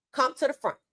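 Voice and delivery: ordinary talk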